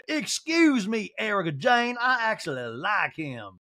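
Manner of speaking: high, drawn-out Southern protest; mock indignation. Housewife objecting that she likes the caller, mock indignant drawl. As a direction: start high and slow, then settle. Mimics Sutton objecting to Erica.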